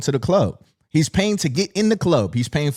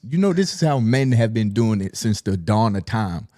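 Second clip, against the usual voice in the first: usual speaking voice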